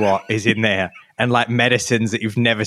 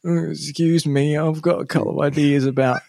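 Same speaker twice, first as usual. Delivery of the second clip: meek traveller; 5 semitones higher than usual. Retold or imitated. Traveller mock-confessing ideas to the security official, lightly voiced meek tone. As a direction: play a sheepish passenger owning up. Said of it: "walk up to the security official and go".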